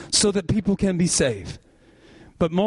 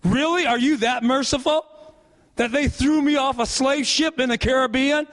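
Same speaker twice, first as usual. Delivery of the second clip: higher-pitched, incredulous questioning of Jesus; the wronged soul demanding answers, voice pitched up; indignant victim; 7 semitones higher than usual. Retold or imitated. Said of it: "they sit there in heaven and say".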